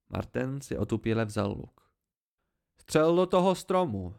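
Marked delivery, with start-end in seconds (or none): 2.8-4.2 loud, high yell to be heard over the storm; shouting over thunder. A narrator's reading. Narrator shouts at Martin over the thunder, loud and high. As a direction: bellow it over the roaring thunder. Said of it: "překřičel jsem hrom".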